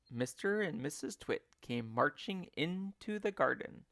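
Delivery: plain reading voice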